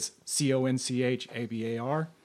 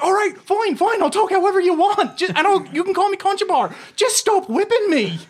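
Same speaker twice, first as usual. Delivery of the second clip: much higher, louder begging voice; mock desperate pleading; 7 dB louder than usual. Begging the captain to stop whipping, high pleading. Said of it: "So I'm like".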